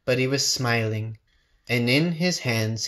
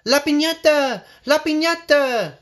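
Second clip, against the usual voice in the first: high, loud gleeful shout; delighted children shouting; excited children's cry; 13 semitones higher, 4 dB louder than usual. Acted. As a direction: call out like eager kids. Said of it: "they cried".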